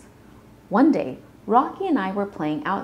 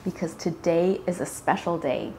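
usual speaking voice

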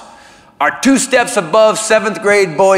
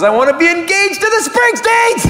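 hammed-up fan yell; 12 semitones higher than usual. Comic admits rings for his heroes, hammed-up fan squeal. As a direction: voice climbing to a high mock squeal.

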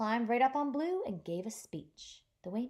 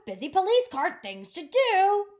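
busy call; 9 semitones higher, 9 dB louder than usual. Acted. Police car calls out busily.

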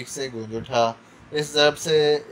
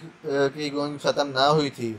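ordinary delivery, nothing marked

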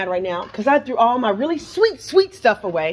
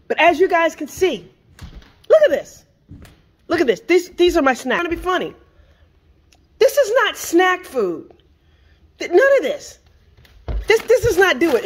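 pitched higher, fed-up complaining; exasperated; 7 semitones higher than usual. Real, not acted. Showing her healthy snacks to viewers, exasperated. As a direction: show them, half-outraged, what she's stuck with. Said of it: "I'm mad right now".

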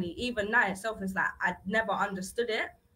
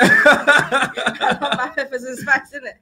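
laughing; 4 semitones higher, 12 dB louder, slower than usual. Real, not acted. Laughing about spicy taste.

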